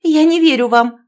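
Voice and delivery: loud, high hysterical cry. A narrator's reading. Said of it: "уже в истерике воскликнула Оленька".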